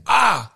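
højt, kraftigt "ah" af smerte; råb op som om nogen træder på dig; smerteudbrud. Retold or imitated. Genfortæller smerte ved trædet.

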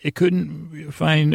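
normal talking voice

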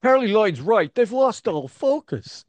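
high, loud mock heckle; pretend outburst. Imagined hotel neighbor punching through drywall, hammed-up yell. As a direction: yell it out like an irate intruder. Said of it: "punched through the drywall now, stuck their head in".

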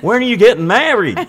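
high, loud put-on Californian voice; mock Californian accent. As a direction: ask like a chirpy Californian host. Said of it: "let me ask the question again".

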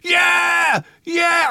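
very high, loud whooping; freak-out shouting. Retold or imitated. Imitating his friend freaking out during the film, freak-out shouting. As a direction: imitate a frenzied fan yelling over the music. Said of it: "He was having a freak out".

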